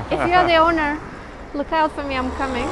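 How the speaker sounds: half-joking call out to the owner; cheeky loud warning; playful loud call. Pretend.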